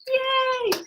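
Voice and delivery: high, drawn-out yay; half-hearted high-pitched celebration; mock cheer. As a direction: cheer in pretend delight. Mock cheer about teenagers at home.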